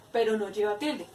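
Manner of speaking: usual speaking voice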